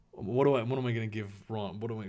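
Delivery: ordinary delivery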